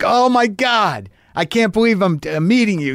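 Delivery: high-pitched, starstruck gushing; lit up. Retold or imitated. Fan meeting his idol at a benefit, lit up. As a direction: gush in disbelief, pitched well up. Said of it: "he was just lit up about it".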